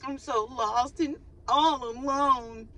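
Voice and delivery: high, slow, theatrical moan; hammed-up lost man's lament, pitched high; melodramatic lament. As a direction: wail it high and slow like a sad soap hero. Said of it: "somebody's mans is out here like".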